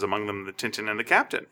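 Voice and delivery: normal talking voice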